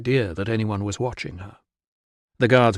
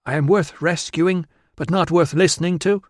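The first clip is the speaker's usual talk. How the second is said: indignant wife; 7 semitones higher than usual. Acted. Officer's wife challenges the soldiers ignoring her, indignant. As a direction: demand to be heard, stung.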